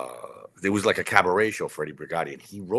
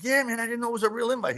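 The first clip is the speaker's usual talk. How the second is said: high, fast, sheepish reply; sheepish excited; faster than usual. Retold or imitated. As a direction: rattle it off high and apologetic.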